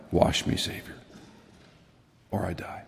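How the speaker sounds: soft, unvoiced plea; hushed whisper of a hymn line; whispered prayer. Real, not acted.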